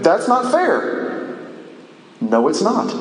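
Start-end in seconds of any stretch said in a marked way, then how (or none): 0.0-1.4 high, drawn-out mock complaint; acted whine of unfairness; mock protest. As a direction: play the objector, pitched up and stretched out. Voices a listener's protest at grace.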